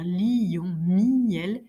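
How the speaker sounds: LION and MIEL each split in two, li-yon, mi-yel; exaggerated diérèse. Shown as a demonstration. Teacher exaggerating the distinction, drawn-out diérèse. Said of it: "je peux exagérer la distinction".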